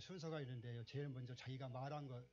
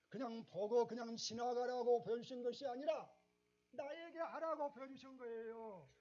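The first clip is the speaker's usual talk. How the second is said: much higher, louder, ringing preaching; climactic preaching; 9 semitones higher, 6 dB louder than usual. Acted. Pastor urging the congregation to act on what God shows, impassioned. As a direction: build to a peak and hammer the point.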